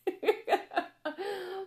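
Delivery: high bright burst of laughter; real laugh. Real, not acted. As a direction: laugh at yourself, high and light. Laughs at her own hippy talk.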